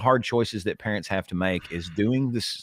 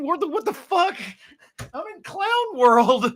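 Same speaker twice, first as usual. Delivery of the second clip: much higher, incredulous outburst; disbelieving squeal; 4 dB louder than usual. Real, not acted. Shocked at agreeing with opponents.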